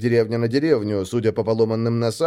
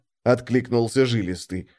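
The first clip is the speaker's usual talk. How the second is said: ordinary delivery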